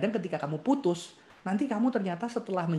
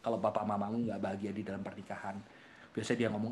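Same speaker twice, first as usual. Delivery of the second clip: normal talking voice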